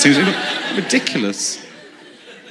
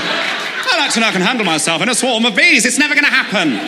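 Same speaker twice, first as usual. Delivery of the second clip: mock earnest self; 7 semitones higher than usual. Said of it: "Just going".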